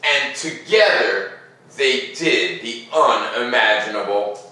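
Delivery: put-on gentle read-aloud to children; storybook narration. A narrator's reading. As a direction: tell it like a bedtime story. Reads picture book aloud.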